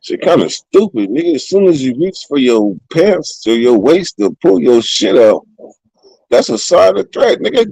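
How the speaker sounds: ordinary talk, nothing marked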